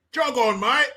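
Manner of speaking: high put-on Cockney accent; joking high-pitched British accent; mock British accent. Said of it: "making fun of these damn accents".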